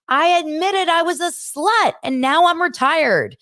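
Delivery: high, emphatic mock-indignant comeback; comic retort. Castmate answering an accusation at dinner, imitated funny defiance. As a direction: act out a proudly defiant declaration. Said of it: "she's so funny. She's like".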